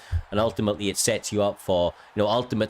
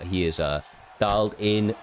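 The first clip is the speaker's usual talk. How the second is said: usual speaking voice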